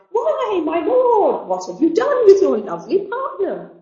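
hammed-up high, loud exclamation; excited cry. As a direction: cry out in swooping mock amazement. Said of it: "stepped hastily up to him and cried".

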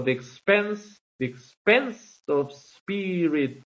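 "the expense of spirit" read slowly, beat by beat; slow scansion reading. Shown as a demonstration. Teacher to class, reading "the expense of spirit" slowly to mark the feet. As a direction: stretch each syllable of "the expense" to show the iambic rhythm. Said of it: "con vocal y empieza con la misma vocal".

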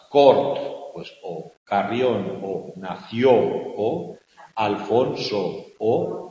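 loud, slow stress on every "O" in the words, one by one; hitting each vowel. Shown as a demonstration. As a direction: punch out each "O" for the class. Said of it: "Lo que estamos repitiendo es la vocal O".

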